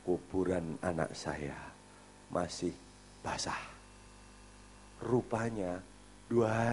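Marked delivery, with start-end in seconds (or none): 0.0-3.6 lower, slow, heavy grief; the father telling about his child's fresh grave, lower and slow; grieving father. Retold or imitated. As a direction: let the words sink, lower and slow. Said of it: "Dia bilang begini".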